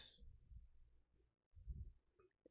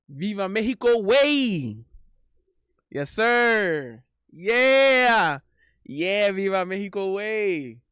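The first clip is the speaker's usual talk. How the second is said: excited cheer; 5 semitones higher, 9 dB louder, slower than usual. Real, not acted. Cheering for Mexico.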